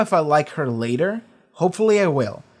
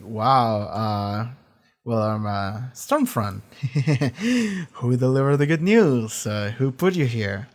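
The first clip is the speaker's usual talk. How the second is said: lower, hesitant Homelander voice; fumbling, lower and stalling; imitating Homelander; 5 semitones lower, slower than usual. Homelander awkwardly questioning Stormfront, lower and halting. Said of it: "Homelander is like".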